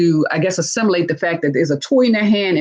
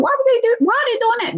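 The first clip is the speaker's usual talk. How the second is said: much higher, baffled question; imitating a confused, hurt partner; bewildered why. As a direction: let the pitch fly up in helpless confusion. Victim puzzled by partner's behaviour.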